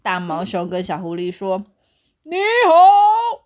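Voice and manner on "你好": loud, high bear voice hello; clueless big bear. A narrator's reading. Bear loudly greeting the angry fox. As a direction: boom the greeting out cheerfully.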